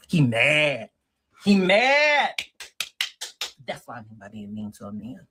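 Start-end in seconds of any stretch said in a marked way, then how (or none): 0.1-2.3 higher, louder teasing sing-song; mocking. Real, not acted. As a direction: tease it, getting higher. Amused mocking repetition.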